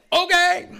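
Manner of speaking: high, loud mock okay; startled compliance. Newcomer just told to shut up, startled compliance. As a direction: imitate a loud, high-pitched, instant surrender.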